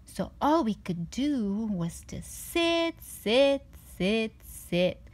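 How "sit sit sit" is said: ordinary delivery, nothing marked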